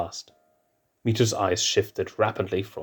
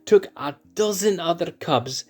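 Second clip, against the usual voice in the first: high, slow incredulous teasing; teasing Mitu; 7 semitones higher, slower than usual. Acted. Mitu teasing Simba about his shyness, high and slow. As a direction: marvel at the fierce prince.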